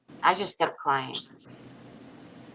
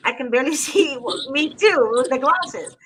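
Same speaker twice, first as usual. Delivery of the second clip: laughing through the words, pitched higher; 8 semitones higher, 4 dB louder than usual. Real, not acted. Laughing at her own poor eyesight while chatting. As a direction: giggle while admitting she can barely see.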